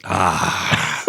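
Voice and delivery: ordinary talk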